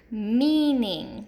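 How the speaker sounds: "meaning" with a drawn-out long E first; stressed first syllable. Shown as a demonstration. Teacher giving an example, "meaning" stressed on the long first syllable. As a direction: stretch the "mean" in "meaning". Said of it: "hear that long E in that first syllable".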